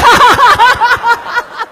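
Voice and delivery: quick amused laugh; high burst of laughter. Real, not acted. Preacher laughing at his own joke about Ahok.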